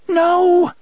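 high, loud desperate scream of no. A narrator's reading. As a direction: shriek it in terror. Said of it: "Jake screamed".

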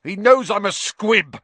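high, loud, hysterical confession; furious, humiliated wail; anguished outburst. A narrator's reading. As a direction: force it out in a high cry. Filch bursts out his confession.

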